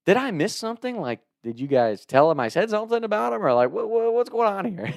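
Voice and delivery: high, baffled questioning; bewildered. Retold or imitated. Bewildered after being cussed out.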